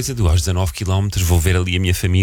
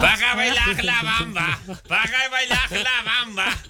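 put-on high, drawn-out German-accented chant; 4 dB quieter, much slower than usual. Co-host asks if they sang with an accent, mock German accent. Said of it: "cantavam com sotaque alemão".

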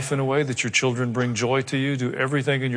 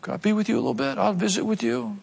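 higher-pitched, unforced polite ask; tentative request; 8 semitones higher, 3 dB quieter than usual. Acted. Believer asking to visit someone who knows God, tentative request. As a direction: ask gently in a raised pitch. Voices asking to spend time together.

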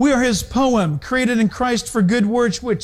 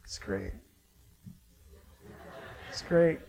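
soft, hushed murmur of wonder; hushed awe; 13 semitones lower, 12 dB quieter than usual. Retold or imitated. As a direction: breathe it out like awestruck onlookers. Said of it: "you could just hear".

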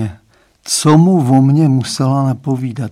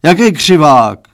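roared; 3 semitones higher, 7 dB louder than usual. A narrator's reading. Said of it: "zařval pan Stránský".

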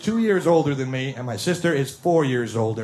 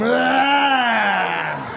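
loud high mock scream of pain; 7 semitones higher, 4 dB louder than usual. Little brother with his arm yanked, loud mock scream. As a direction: yelp like a kid in an arm lock. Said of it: "How loud can he scream?".

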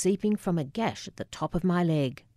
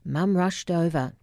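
usual speaking voice

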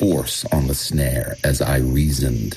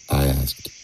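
ordinary delivery, nothing marked

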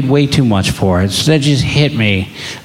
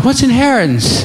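put-on much higher, childlike asking; higher innocent child voice; innocent kid question; 11 semitones higher than usual. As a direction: ask it like a curious little boy. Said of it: "And then he goes".

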